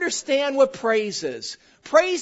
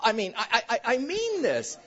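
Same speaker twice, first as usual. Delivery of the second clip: normal talking voice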